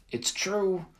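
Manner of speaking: high urgent cry; yelled. Acted. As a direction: insist it, voice jumping up. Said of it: "I yelled".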